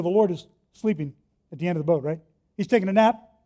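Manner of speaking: ordinary delivery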